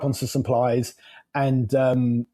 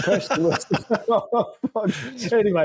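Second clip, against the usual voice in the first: laughing; 5 semitones higher, much slower than usual. Real, not acted. Laughs at his tangent.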